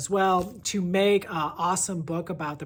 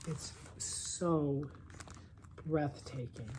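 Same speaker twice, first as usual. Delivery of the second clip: awed whisper; 8 dB quieter, much slower than usual. Real, not acted. Awed by photos of nature.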